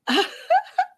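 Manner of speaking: squealing laugh; high-pitched burst of laughter. Real, not acted. Laughs at her own joke.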